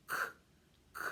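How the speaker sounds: "k" as a sharp breathy k sound; letter sound demo. Shown as a demonstration. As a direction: model the bare "k" sound. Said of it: "The letter makes the sound k".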